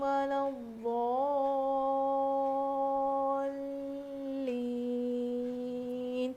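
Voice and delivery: slow, drawn-out melodic tajweed recitation; slow melodic Quranic chant. Shown as a demonstration. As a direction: chant each word with tajweed, unhurried.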